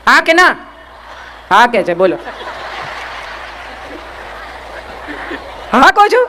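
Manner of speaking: chuckling, teasing question; laughing teasingly. Real, not acted. Speaker teasing women who agree but never act, laughing. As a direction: laugh at them as you ask.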